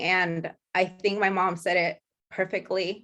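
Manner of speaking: ordinary talk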